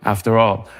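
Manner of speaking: usual speaking voice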